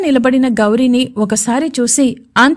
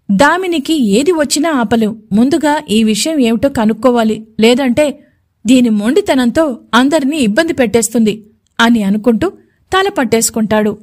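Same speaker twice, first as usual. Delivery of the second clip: ordinary delivery, nothing marked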